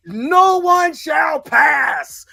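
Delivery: slow, loud, high booming wizard decree; Gandalf impression. [name] blocking the Jedi like Gandalf, booming slow decree. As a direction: shout it slowly like Gandalf blocking the bridge. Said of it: "Gandalf type shit".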